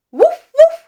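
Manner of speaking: two very high, loud woofs; high-pitched double bark; dog bark. Shown as a demonstration. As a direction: yap like a dog for children.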